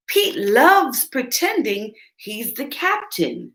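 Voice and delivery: plain reading voice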